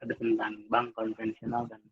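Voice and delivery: ordinary delivery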